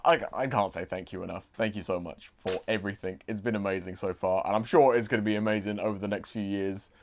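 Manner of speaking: soft, low, heartfelt thank you; sincere soft thanks. Real, not acted. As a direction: speak gently from the heart. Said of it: "what am I talking like?".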